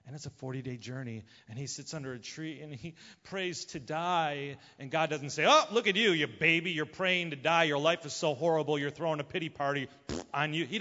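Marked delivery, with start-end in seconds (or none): 5.5-10.7 mocking scolding. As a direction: scoff at him like a whiny baby. God mocking a self-pitying Elijah.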